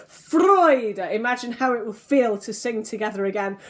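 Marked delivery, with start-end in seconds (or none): 0.1-1.0 Freude sung out higher and louder with joy; full of joy. Shown as a demonstration. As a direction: burst out Freude with real joy. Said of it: "as if you're full of joy".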